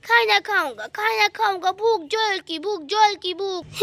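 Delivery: put-on hungry little child's lisping voice; Guddu. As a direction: babyish sing-song whine for food. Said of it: "Guddu की नींद खुल जाती है". (भूखे बच्चे की तोतली आवाज़)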